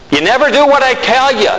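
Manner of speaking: mock an exasperated God scolding him; nagging God. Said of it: "Do you think God speaks like that?".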